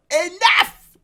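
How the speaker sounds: high, loud outburst; angry shout. Acted. Nagi shouting at the old companion to stop. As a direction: cut him off in anger.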